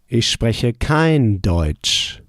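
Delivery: slowly spelled-out "ich spreche kein Deutsch"; careful teacher pace; slow model answer. Shown as a demonstration. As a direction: give the learner time to follow. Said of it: "How would you say".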